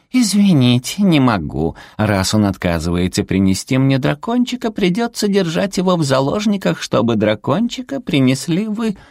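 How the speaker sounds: high, sugary little hostage-taker; cutesy creature villain. A narrator's reading. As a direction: threaten sweetly in a high little voice. Зверёк угрожает заложником. (сладкий высокий голосок зверька-злодейки)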